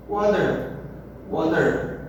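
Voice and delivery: Water with a D-like flap; American water. Shown as a demonstration. Teacher repeating the American water, T as D. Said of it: "parecido com um D".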